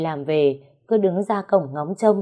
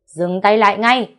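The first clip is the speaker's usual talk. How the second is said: louder commanding stop; shouted order; 3 semitones higher, 7 dB louder than usual. A narrator's reading. Cậu hai stopping the fight, loud shouted command. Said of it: "quát lên".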